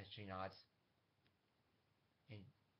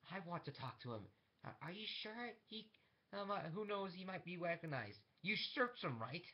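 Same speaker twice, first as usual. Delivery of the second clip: higher, anxious questioning voice, lightly acted; lightly voiced doubtful interrogator, pitched up; interrogators behind glass; 9 semitones higher, 4 dB louder than usual. People behind the glass discussing a prisoner, lightly voiced interrogators.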